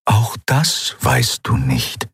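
very slow, measured apparition; otherworldly eidolon, calm. Acted. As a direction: answer calmly, stretching each word.

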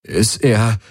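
halting, broken-off question; put-on choked worry; voice breaking. A narrator's reading. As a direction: let the voice falter as if too upset to finish. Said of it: "ließ seine Stimme brechen".

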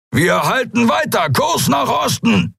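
high, forceful captain's shout; drawn-out roar at the end; shouted command. A narrator's reading. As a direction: shout the heading over the battle. Captain's order in battle.

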